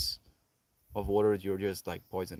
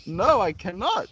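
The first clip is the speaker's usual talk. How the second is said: higher, exaggerated refusal; mock distressed retort; 9 semitones higher, 4 dB louder than usual. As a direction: imitate a worrier protesting. Voices the worried person's retort.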